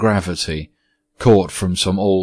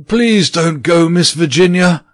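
lonely ghost begs Virginia to stay, pleading; pleading cry; 9 semitones higher, 5 dB louder than usual. Acted. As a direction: beg her not to leave. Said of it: "he cried".

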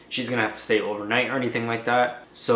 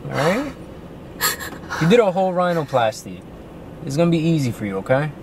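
reassuring; 5 semitones higher than usual. Real, not acted. Husband comforting anxious wife.

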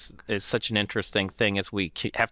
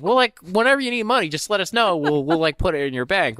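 much higher, louder, airy and offhand; mock breezy employer; 8 semitones higher, 5 dB louder than usual. Employer brushes off a new hire's pay question, airy and high. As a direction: toss off the answer as if it's nothing. Employer voiced, mocking vague answer.

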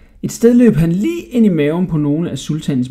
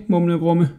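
usual speaking voice

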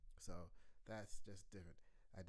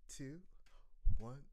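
ordinary talk

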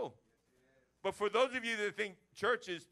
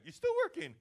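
1 mock surprise; 12 semitones higher, 5 dB louder than usual. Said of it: "the U is for you".

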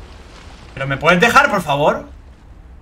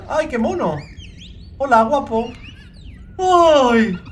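fond, cutesy greeting; cooing at a cute creature; 7 semitones higher, slower than usual. Real, not acted. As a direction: coo at it like a pet. Meets cute sea monkey creature.

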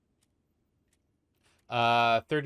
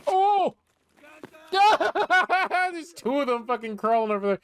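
high, loud startled laugh; yelp, then laugh it out; surprised laughing shout; 8 dB louder than usual. Real, not acted. Sudden enemies spotted.

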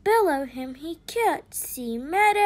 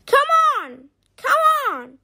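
high, sharp-tempered call, a bit louder; cross shout; 9 semitones higher, 4 dB louder than usual. A narrator's reading. Leather Queen shouting at Chip, cross and high. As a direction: shout it crossly, pitched well up. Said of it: "she shouted crossly".

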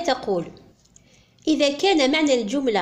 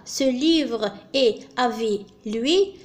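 slow, showing the substituted words; slow wrong variant; much slower than usual. Shown as a demonstration. Teacher showing students the faulty substitution, slowly. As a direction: read out the broken sentence carefully. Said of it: "تصبح الجملة".

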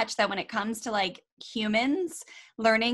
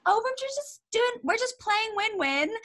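put-on much higher, small toddler voice; three-year-old voice; 12 semitones higher than usual. Mother imitating a toddler explaining her game, high and small. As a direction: chirp it like a tiny girl caught playing. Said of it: "And she goes".

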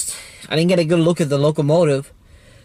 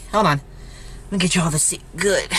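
ordinary delivery

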